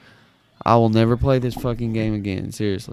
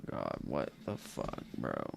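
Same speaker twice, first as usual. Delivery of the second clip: stunned hush; 14 semitones lower, 18 dB quieter than usual. Real, not acted. Streamer staring at missing saves, hushed disbelief. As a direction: let it slip out under your breath.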